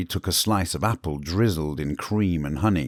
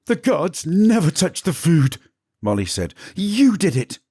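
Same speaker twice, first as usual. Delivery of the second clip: accusing servant; 11 semitones higher, 4 dB louder than usual. Acted. Molly accusing Stefan.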